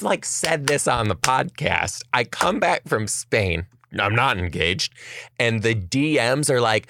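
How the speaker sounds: ordinary talk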